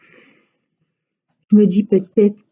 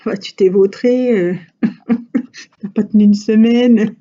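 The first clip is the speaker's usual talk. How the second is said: laughing tease; 3 semitones higher, 7 dB louder than usual. Acted. Teasing a candidate who got out.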